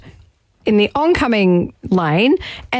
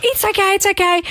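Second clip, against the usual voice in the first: high soothing repeat; calm the scared boy, up in pitch; retold comfort to a frightened teen; reassuring; 9 semitones higher than usual.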